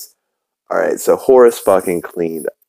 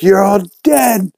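mock killer cry, pitched way up; killer's threat; 12 semitones higher than usual. Steve the killer bursting in on prisoners, mock menacing cry.

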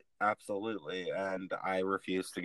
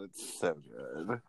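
usual speaking voice

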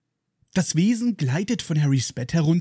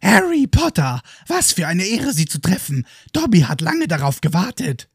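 excited squeaky elf greeting; squeaky house elf; 3 semitones higher than usual. Acted. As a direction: chirp it like an annoying little elf. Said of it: "Mit einer extrem nervigen Piepsestimme ruft die Kreatur".

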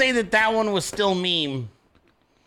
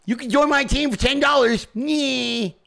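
higher mock plea ending in a nyeh; mocking crooked-jaw politician; 4 semitones higher than usual. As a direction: mimic a politician talking through a skewed jaw. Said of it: "Why is his jaw like that?".

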